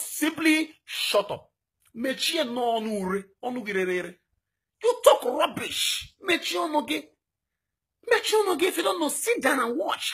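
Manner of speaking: angry shout. Real, not acted. Activist scolding doubters to shut their mouths, furious shout. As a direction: bark it at the critics.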